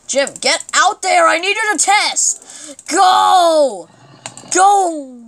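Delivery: frustrated shout at a zombie; shouting orders. Real, not acted. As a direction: yell at Jim to get out there. Yelling at zombie to go.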